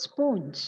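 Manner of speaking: sponge with a long O, SPOHNJ; common mispronunciation. Shown as a demonstration. Teacher reading the given error, sponge as SPOHNJ. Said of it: "commonly heard mispronunciation of the word".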